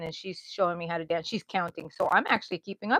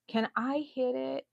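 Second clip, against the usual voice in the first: slower, higher retold request; pleading ask; 5 semitones higher, 5 dB quieter than usual. Woman asking to hit the piñata, pleading. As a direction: ask like an eager kid in line.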